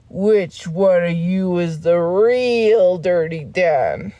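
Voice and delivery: put-on voice, slow and drawn out; slow dramatic cartoon voice. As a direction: ask it like a mock-dramatic showdown. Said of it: "they're like".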